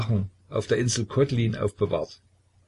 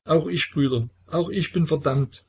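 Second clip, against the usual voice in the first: high, tearful confession; childlike sobbing; 8 semitones higher than usual. A narrator's reading. Petka confessing to the brothers he is damned, high and tearful. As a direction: whimper it like a doomed child. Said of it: "wie ein Kind schluchzend".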